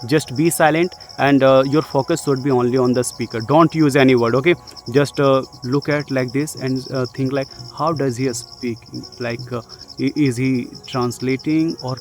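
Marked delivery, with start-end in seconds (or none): none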